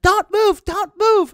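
loud falsetto girl imitation; very high, loud mock command; high-pitched voice. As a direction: mimic her bossy high shriek. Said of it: "with her, like, high-pitched voice".

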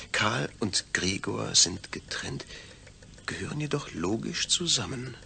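normal talking voice

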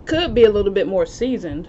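talking between bites of pizza; chewing while talking. Real, not acted. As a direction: mouth partly full of food. Seen 2: chewing visible just before, slice in hand, cheeks somewhat full.

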